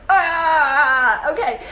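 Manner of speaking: half-laughing, half-crying ah; laughing groan. Retold or imitated. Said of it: "I was like".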